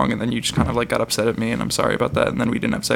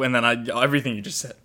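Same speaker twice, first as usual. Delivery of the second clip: usual speaking voice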